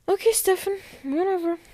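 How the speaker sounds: high, singsong brush-off; dismissive. Real, not acted. Vlogger to Stefan over a baffling line, dismissive.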